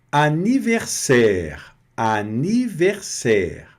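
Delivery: anniversaire said slowly, syllable by syllable; slow model word. Shown as a demonstration. Teacher modeling anniversaire slowly for students. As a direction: spell out anniversaire slowly for the class. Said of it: "Anniversaire quer dizer".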